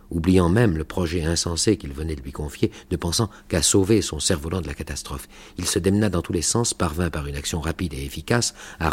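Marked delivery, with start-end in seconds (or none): none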